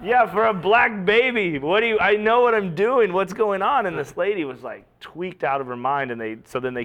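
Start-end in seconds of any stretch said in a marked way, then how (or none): 0.0-3.9 loud, high mock comeback; indignant retort. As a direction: fire back at a heckler, hammed-up. Retorts to the yelling heckler.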